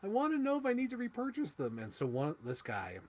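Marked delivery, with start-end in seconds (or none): 0.0-1.8 much higher, whiny mock self-quote; imitating himself. Quoting his own toy hunt, high whiny voice. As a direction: whine like a kid hunting toys. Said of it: "I'm like".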